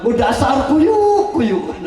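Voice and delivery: mocking mother's scold. Preacher retelling a mother's insult, laughing mock scold. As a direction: drag out the insult, half laughing. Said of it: "Saya pernah dengar".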